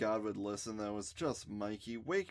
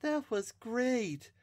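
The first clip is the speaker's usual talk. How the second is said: put-on childlike awe; 12 semitones higher, much slower than usual. A narrator's reading. Mia in wide-eyed awe.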